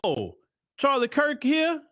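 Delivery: high, slow, mock surprised question; Trayvon in heaven. Trayvon spots Charlie Kirk arriving, high slow mock surprise. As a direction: draw it out, pitched high and amused. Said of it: "Trayvon probably was waiting on him too".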